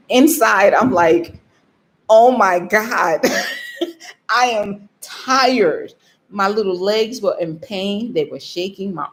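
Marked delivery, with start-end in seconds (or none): 2.1-5.9 slow, drawn-out groaning complaint, mock-weary; exasperated exhaustion. Hiker privately admits how tired she is, drawn-out exasperation. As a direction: drag each word out, laughing midway. Acts out exhausted inner reaction.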